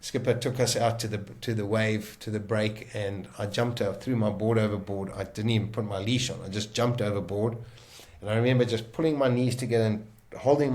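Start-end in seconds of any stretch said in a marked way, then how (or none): none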